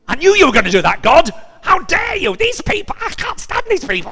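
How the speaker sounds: hammed-up ranting prophet; furious Jonah. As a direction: shout it at God in indignant outrage. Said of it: "Jonah is absolutely furious".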